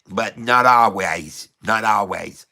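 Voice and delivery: lightly put-on New Hampshire accent, slowed; a hint of a New Hampshire drawl, teasing; mild mock New Hampshire accent. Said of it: "I don't know if he talks like this".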